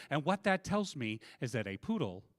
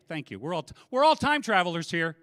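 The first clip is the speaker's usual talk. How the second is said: ordinary talk, nothing marked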